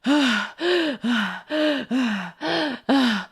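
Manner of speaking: long exhausted sigh; long panting sigh. Acted. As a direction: heave it out spent. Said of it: "I'm just, like".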